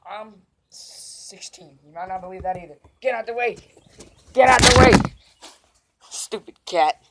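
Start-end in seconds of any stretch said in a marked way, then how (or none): 3.0-7.0 loud shout, much louder on the second "way"; yelling at cat. Real, not acted. Shouting at the cat to get out of the way.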